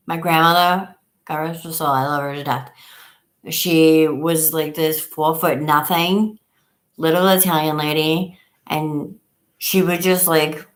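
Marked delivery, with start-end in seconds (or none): none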